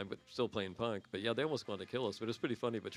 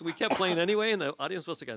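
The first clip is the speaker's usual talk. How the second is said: ordinary delivery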